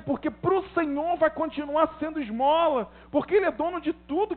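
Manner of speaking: high, insistent pulpit voice; impassioned preaching. Real, not acted. Preacher explains what to ask of the Lord, impassioned high-pitched preaching. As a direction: press the point on the congregation. Preaches at full force to congregation.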